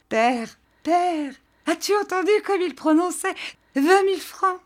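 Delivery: bubbling over with amused disbelief; laughing mockery. A narrator's reading. Jeanne mocking her husband to her father, laughing high. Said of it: "se mit brusquement à rire".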